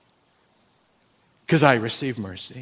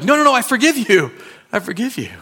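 higher and louder, a rushed no no no then I forgive you; urgent forgiveness; 9 semitones higher, 6 dB louder than usual. Acted. Pastor voicing himself begging the man not to go, urgent. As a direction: rush to stop them, pleading.

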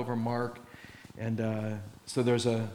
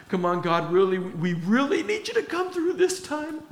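higher, pleading, hammed-up begging; mock frantic prayer; 10 semitones higher than usual. Preacher mocking his own frantic prayer, higher. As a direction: pretend to beg God in a panic. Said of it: "I'm whooping it up".

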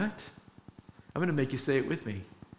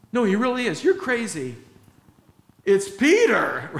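higher, louder pleading insistence; insistent Rhoda; 6 semitones higher, 6 dB louder than usual. Retold or imitated. Rhoda insisting to the disciples, higher and louder. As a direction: make them believe you.

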